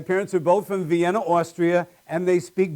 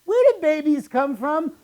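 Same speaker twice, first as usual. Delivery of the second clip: put-on high little kid voice; high child's question; child asking; 8 semitones higher than usual. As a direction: ask innocently like a small child. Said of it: "ask my parents a question".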